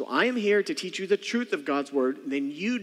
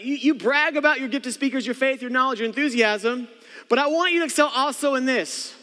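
higher, louder pulpit push; forceful preaching; 9 semitones higher, 5 dB louder than usual. Acted. Pastor confronting the bragging church, loud preaching. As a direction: press the point hard. Preacher drives home point.

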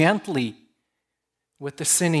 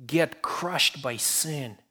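ordinary talk

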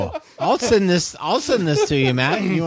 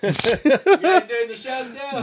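off-mic laughing call; 10 semitones higher, slower than usual. Real, not acted. Calling back from afar.

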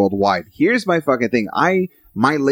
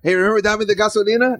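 mock [name]; 9 semitones higher than usual. Mocking [name]'s old hit.

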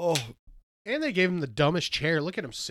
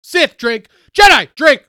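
loud game call, voice raised high; shouting; 12 semitones higher, 20 dB louder, slower than usual. Real, not acted. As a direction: bellow the drink call. Calling out drinking game rule.

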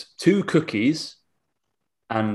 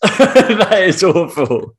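laughing; 9 semitones higher, 8 dB louder, much slower than usual. Real, not acted. Laughing at awful pie stats.

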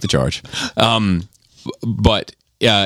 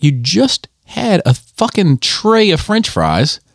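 exasperated scolding; 8 semitones higher, slower than usual. Retold or imitated. Retold scolding of daughter.

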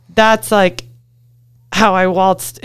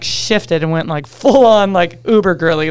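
ordinary talk

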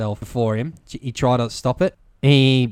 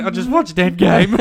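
high, loud, blubbering complaint; mock tearful wail; 6 semitones higher, 5 dB louder than usual. Telling his wife on the phone, loud tearful whine. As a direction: whine it like you are still crying. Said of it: "I'm like".

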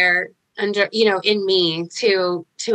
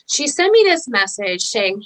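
ordinary delivery

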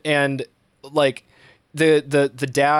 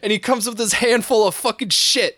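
normal talking voice

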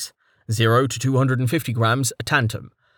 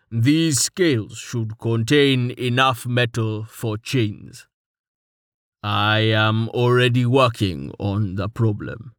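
lightly voiced gruff man; slower than usual. A narrator's reading. Torwart speaks, slow and different.

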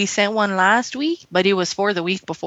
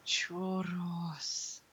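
held mock Mexican accent.

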